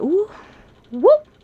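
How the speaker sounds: high, loud startled whoop; surprised whoop. Real, not acted. Tarot reader startled by a card, high surprised whoop. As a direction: yelp at the card that turned up.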